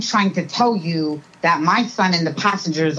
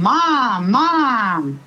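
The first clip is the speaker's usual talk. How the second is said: higher, drawn-out cry for Mom; mock yell, stretched out without real volume; imitated child's yelling; 4 semitones higher than usual. Grandmother imitating her upset grandson yelling for his mother. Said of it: "and yelling".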